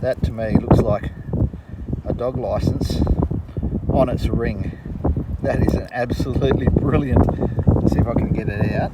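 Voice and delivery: loud outdoor voice over wind; shouting over wind. Real, not acted. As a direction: call it out above the gusts. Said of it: "I hope you can hear me over this wind".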